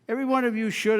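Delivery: firm debate emphasis on should; stress on should. Real, not acted. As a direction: lean on the word should to make it count. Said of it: "and I emphasize the word should".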